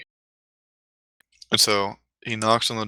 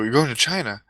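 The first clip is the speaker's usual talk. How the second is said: mildly surprised parents' question; incredulous echo; 2 semitones higher than usual. Acted. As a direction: echo the news as a puzzled question. Parents echo his news in disbelief.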